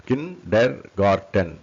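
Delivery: slow KIN - DER - GAR - TEN; syllable by syllable. Shown as a demonstration. Teacher demonstrates four syllables, syllable by syllable. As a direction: break kindergarten into four beats.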